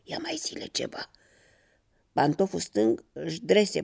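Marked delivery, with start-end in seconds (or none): 0.0-1.1 soft, eager whisper; delighted whisper. A narrator's reading. Right slipper urging its mate to go on, delighted whisper. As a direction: whisper it, egging a friend on. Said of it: "șopti încântat pantoful drept".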